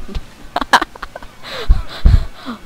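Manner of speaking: unvoiced high giggling fit; high laughing fit. Real, not acted. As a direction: dissolve into giggles. Laughing fit during game.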